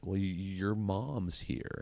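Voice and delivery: very slow, halting delivery; hesitant counselor. Retold or imitated. Counselor telling the camper his mom came, slow and halting. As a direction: stall nervously before the bad news.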